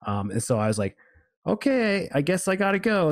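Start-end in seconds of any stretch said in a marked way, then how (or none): 1.5-3.1 awkward retold exit. Scared dreamer excusing himself to leave, awkward high voice. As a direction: pitch it way up, as if excusing yourself.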